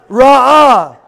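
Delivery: "Ra'ah" loud and drawn out, R trilled; rolled R. Shown as a demonstration. Preacher returns to the Hebrew word "Ra'ah", trilling it loudly. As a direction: roll into "Ra'ah" strongly and stretch it. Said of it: "the word in the Hebrew for provided".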